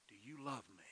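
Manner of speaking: hushed, intimate question with a falling end; gentle question. Acted. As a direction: ask it quietly, almost under your breath. Acts Jesus gently asking Peter.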